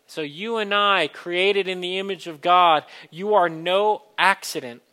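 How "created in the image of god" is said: normal talking voice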